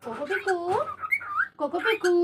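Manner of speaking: high, singsong call with rising end; calling pets. Real, not acted. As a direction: call out to your pet birds by name. Calling pet birds by name.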